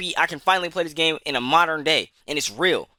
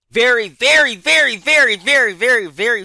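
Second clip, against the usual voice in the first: each very louder and much higher; hammered repetition; 8 semitones higher, 8 dB louder than usual. Real, not acted. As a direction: pound every very. Ranting how rare good games are.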